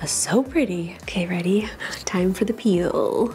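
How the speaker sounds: hushed unvoiced whisper; real whisper over the unboxing. Real, not acted. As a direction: whisper it as if someone might hear. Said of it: "I'm, like, whispering".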